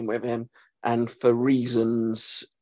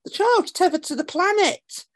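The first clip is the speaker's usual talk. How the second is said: much higher, mocking sing-song read; mock script quote. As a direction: squeak the line out like a silly quote from the script. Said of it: "script says".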